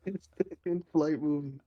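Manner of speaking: high voice breaking into laughter; helpless real giggles; cracking up. Real, not acted. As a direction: squeeze the words out through laughter. Cracking up at running theater joke.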